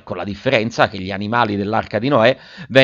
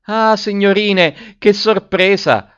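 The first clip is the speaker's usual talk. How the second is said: much higher, slow, louder exclamation; exclaiming maid; 10 semitones higher, 4 dB louder, slower than usual. A narrator's reading. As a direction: announce the surprise with wide-eyed drawn-out wonder.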